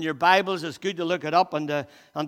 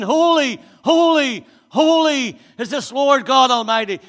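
proclaiming; 8 semitones higher, 12 dB louder than usual. Acted. Preacher proclaiming seraphs' cry.

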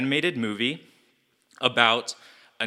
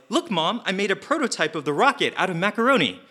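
put-on high, eager little boy; eager kid voice; 9 semitones higher than usual. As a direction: pitch up like a proud child showing his drawing. Young Gru shows mom prototype.

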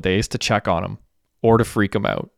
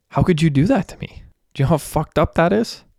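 higher-pitched aggrieved disbelief; upset, accusing; 8 semitones higher than usual. Acted. Derek confronts the friends who robbed his store, upset. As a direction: plead and accuse at once, pitch raised.

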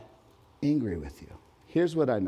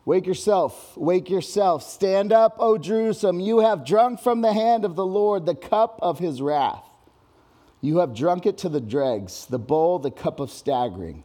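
loud, high-pitched proclamation of wrath; forceful scripture reading; 8 semitones higher, 6 dB louder than usual. Acted. Preacher to the congregation, reading God's wrath in a booming voice. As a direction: thunder God's warning over the room.

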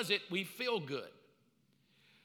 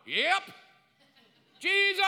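high, loud yell of Jesus; imitating a shouter at church; imitated shout; 12 semitones higher, 9 dB louder than usual. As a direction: belt it from the back row. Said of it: "Sam is a shouter".

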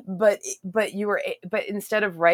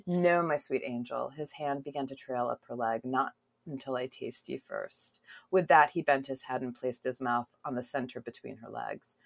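sultry reading; 5 semitones lower, 9 dB quieter than usual. Acted. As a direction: read it low and intimate. Continues reading romance excerpt.

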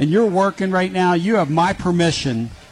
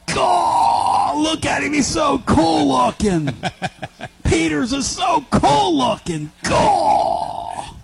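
excited shout; 10 semitones higher, much slower than usual. Real, not acted. Host spotting guest Jim Peters arriving, shrieking with joy. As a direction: whoop at the sight of a friend.

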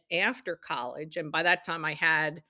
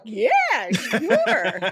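high, loud eager agreement; eager yes; 8 semitones higher, 10 dB louder than usual. Retold or imitated. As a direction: blurt out a delighted yes with a laugh. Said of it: "I was just like".

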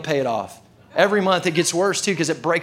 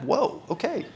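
high, soft mock surprise; a small, high-pitched whoa of bafflement; taken aback; 7 semitones higher, 4 dB quieter than usual. Customer watching the teller type away, mildly taken aback.

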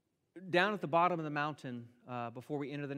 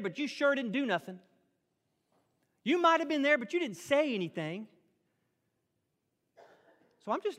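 put-on whiny three-year-old sulking at God, higher and louder; childish tantrum; 6 semitones higher, 7 dB louder, faster than usual. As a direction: throw a toddler's tantrum, pouting and stamping. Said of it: "Do we sound like a, a three-year-old?".

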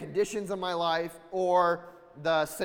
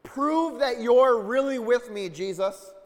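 Peter demanding proof; 4 semitones higher, 6 dB louder than usual. Retold or imitated. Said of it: "he's like".

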